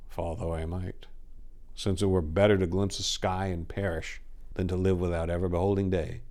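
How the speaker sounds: plain reading voice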